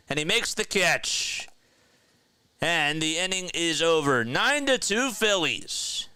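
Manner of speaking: radio baseball announcer; live play-by-play. Acted. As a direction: call the play as it happens.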